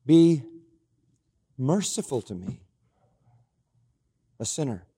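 retold penitent prayer, hushed by the end; penitent plea. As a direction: plead humbly as the publican, long pauses, dropping away. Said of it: "the publican beating on his chest saying".

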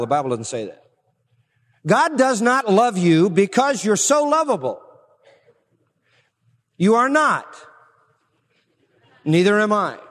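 ordinary talk

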